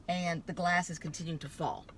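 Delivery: ordinary talk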